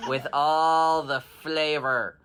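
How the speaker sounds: laughing through the words, slow. Real, not acted. Storyteller cracking up at her own story, laughing. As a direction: giggle while stretching the line out. Teller laughs mid-sentence.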